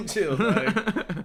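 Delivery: laughing plea. Real, not acted. Joking at people spreading death rumors, laughing plea.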